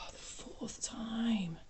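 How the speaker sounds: whispered, slow and unvoiced; exasperated whisper. Real, not acted. Tarot reader whispering to herself at a repeated card, exasperated. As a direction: breathe it out in disbelief.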